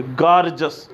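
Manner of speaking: गॉर्जस as gor-jus, two syllables; correct pronunciation. Shown as a demonstration. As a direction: say गॉर्जस, never gor-jee-us. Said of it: "गॉर्जियस नहीं पढ़ोगे". (गॉर्जस दो हिस्सों में, गॉर-जस)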